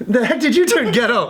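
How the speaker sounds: high, laughing astonishment; amused disbelief. Retold or imitated. Man to his suddenly tough girlfriend, incredulous. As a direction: act out being stunned, through laughter.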